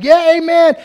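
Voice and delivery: high, loud call of amen; mock shout. Preacher imitating a churchgoer yelling amen, high and loud. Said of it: "he says something like".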